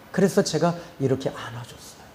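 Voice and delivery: ordinary talk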